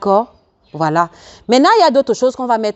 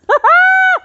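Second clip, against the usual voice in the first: short, high, loud burst of laughter; high cackle; 6 dB louder than usual. Real, not acted. Laughs after the remark. (éclat de rire aigu)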